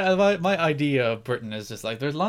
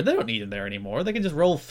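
normal talking voice

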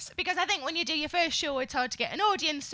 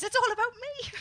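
mock grandiose; 9 semitones higher than usual. Mock self-obsessed show theme.

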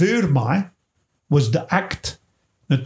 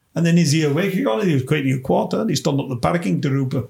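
normal talking voice

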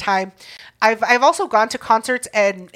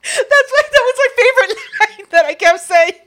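real laughter breaking through, pitched high; laughing hard; 11 semitones higher, 5 dB louder than usual. Real, not acted. Cracking up at her favorite line, laughing hard. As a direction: squeeze the words out through laughter.

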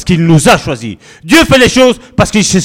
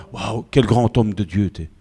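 mock awe; 5 semitones lower, 5 dB quieter than usual. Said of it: "la personne qui est en face de toi".

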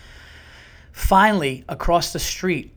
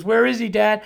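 put-on high, louder child's question; imitating son; 7 semitones higher, 5 dB louder than usual. Son asking dad about the lost dog, high and hopeful. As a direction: voice an eager kid at the door. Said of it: "He goes".

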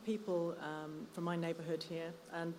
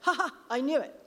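loud, higher crowing; triumphant gloating; 6 semitones higher, 7 dB louder than usual. Acted. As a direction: crow over catching him out. Eric triumphs over the boy.

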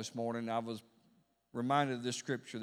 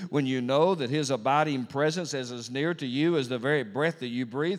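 insistent cadence on each "when you know", growing louder; rhythmic preaching; 6 dB louder than usual. Real, not acted. Preacher tells the congregation what happens, rhythmic building preaching. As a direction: build the repeated clauses in a steady, driving roll.

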